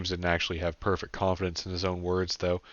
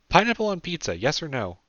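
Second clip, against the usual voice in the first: put-on higher young woman's voice; Sarah's voice; 9 semitones higher, 5 dB louder than usual. A narrator's reading. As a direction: pitch it up for Sarah. Sarah's playful question, higher.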